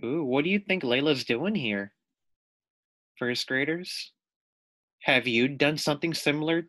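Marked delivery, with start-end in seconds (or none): none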